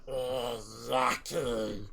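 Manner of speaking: long drawn-out creature-like voice; Lugum in character. Acted. Lugum agrees about the mud, drawn-out character voice. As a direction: stretch the word right out in an odd put-on voice. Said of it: "Lugum's just like".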